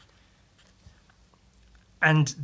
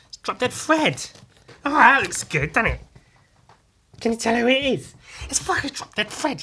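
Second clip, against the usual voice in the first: high, bright-voiced showing off; high excited reveal; 7 semitones higher than usual. Real, not acted. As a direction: show off the drawing, bursting with glee.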